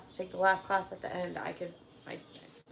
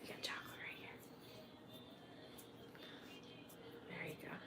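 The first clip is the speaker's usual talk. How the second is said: whispering; 26 dB quieter, faster than usual. Real, not acted. Wiping child's face.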